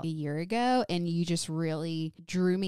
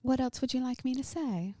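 softer, higher British accent; proper British accent; 5 semitones higher, 4 dB quieter than usual. Shown as a demonstration. Co-host asking friends what to say, proper British accent. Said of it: "like, proper".

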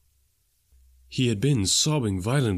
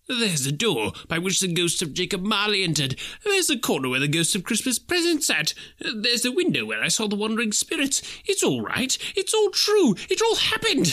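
higher, breathless delight; Scrooge delighted; 10 semitones higher than usual. A narrator's reading. Scrooge winded, rediscovering his room with delight. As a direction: point everything out gleefully.

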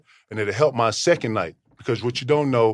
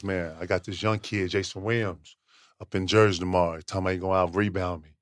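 a hint of Rodman; 7 semitones lower than usual. Rodman brags about out-rebounding a young rival, low and cocky. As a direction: brag low and easy about the next game. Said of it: "He starts talking in third person".

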